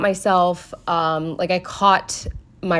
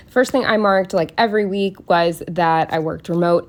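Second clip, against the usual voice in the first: ordinary talk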